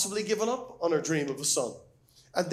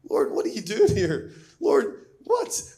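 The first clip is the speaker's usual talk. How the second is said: louder, rising in disbelief; anguished cry to God; 4 semitones higher, 5 dB louder, slower than usual. Acted. Preacher crying out to God at the boy's death, disbelieving and louder. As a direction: cry out to the Lord in shock.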